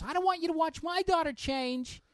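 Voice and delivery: loud, high mock mother voice; indignant mom. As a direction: imitate an outraged mom objecting. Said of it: "this mom chimes in".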